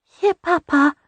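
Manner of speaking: put-on a baby offering a treat; toddler voice. Toddler daughter offers her papa a soggy biscuit.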